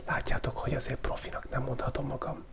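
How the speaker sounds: usual speaking voice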